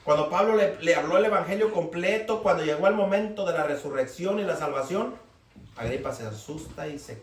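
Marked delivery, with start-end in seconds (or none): none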